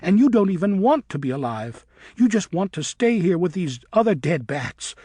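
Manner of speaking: put-on girl bat shouting at her friend in anger. A narrator's reading. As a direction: hurl each line at him. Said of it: "She was shouting loudly now".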